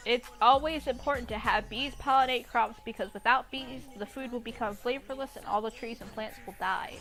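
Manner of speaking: ordinary talk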